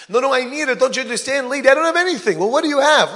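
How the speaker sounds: high, loud back-and-forth at the door; heated argument. Acted. Beggar and homeowner arguing at the door, loud and high. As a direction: push back and forth, voices up.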